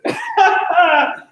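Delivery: high burst of laughter; hearty laugh. Real, not acted. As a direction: break out in a rapid, high laugh. Laughs at the Aladdin remark.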